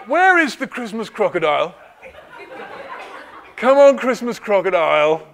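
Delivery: loud playful coaxing; coaxing call. Real, not acted. Coaxing a child forward.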